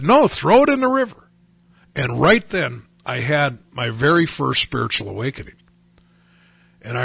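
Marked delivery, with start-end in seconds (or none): 0.0-1.3 high, urgent counter-order; insistent commanding voice. Retold or imitated. Retelling the voice he heard by the river, insistent. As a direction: overrule the tempter, pitched well up. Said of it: "And this other voice said".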